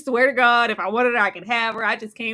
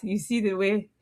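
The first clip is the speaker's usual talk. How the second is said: normal talking voice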